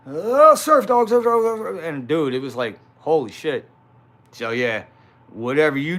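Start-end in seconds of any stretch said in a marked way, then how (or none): 0.1-1.8 much higher and much louder, squealing the name over and over; mocking the rival. Mocking the rival who kept calling Surf Dog at dawn, high shrill squeal. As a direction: shriek the name like an obsessed fan at six in the morning. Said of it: "it would be like".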